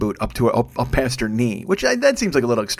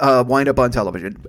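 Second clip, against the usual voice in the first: usual speaking voice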